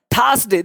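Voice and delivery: "थर्सडे" as thaas-day, R barely there; correct pronunciation. Shown as a demonstration. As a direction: open "थर्सडे" on a long "था". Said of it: "ध्यान देना, इसको थर्सडे नहीं". ("थर्सडे" में "था", र हल्का)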